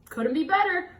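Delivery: loud, high cheerful answer; happy reply. Acted. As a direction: answer with gusto. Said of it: "replied Mister Pipe".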